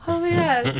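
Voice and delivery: high, loud tearful moan; overwhelmed, high-pitched retold cry; mock tearful. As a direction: act out breaking into tears. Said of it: "And then I start crying again".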